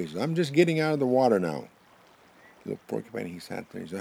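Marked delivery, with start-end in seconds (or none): 0.2-1.7 loud, high-pitched answer; calling back. Acted. Buffalo answers the porcupine in his stomach, calling back. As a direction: holler the progress report, voice up high.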